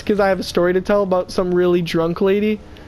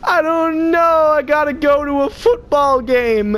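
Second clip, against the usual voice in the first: put-on drunk lady; 6 semitones higher, slower than usual. Drunk lady voiced in story.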